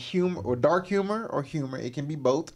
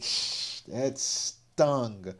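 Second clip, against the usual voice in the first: sucked-in hiss, then a quieter mock wince; wincing sympathy; 3 semitones lower, 6 dB quieter, much slower than usual. Partner in the background reacts to the hit, wincing. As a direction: imitate an onlooker feeling the sting. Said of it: "his partner in the background making a stink face like".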